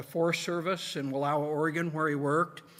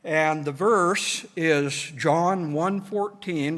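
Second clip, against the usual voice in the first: ordinary talk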